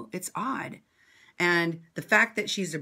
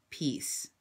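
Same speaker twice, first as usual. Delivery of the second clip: ordinary talk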